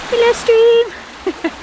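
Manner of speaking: high, slow sing-song hello; playful high greeting. Real, not acted. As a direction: greet the stream like a friend.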